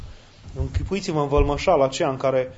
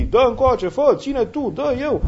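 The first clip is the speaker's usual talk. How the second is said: high, urgent mob shouts; imitated shouting; 9 semitones higher, 3 dB louder, slower than usual. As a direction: call out over one another, pitched right up. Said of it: "strigă unii la alții". (strigăte imitate, pe ton înalt)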